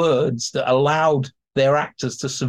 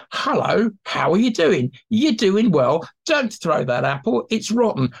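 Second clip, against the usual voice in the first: cheeky higher clown banter to the audience; clown addressing the crowd; 6 semitones higher than usual. Shown as a demonstration. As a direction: tease the crowd like a stage clown. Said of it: "this is direct address to an audience".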